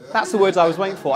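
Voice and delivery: ordinary talk, nothing marked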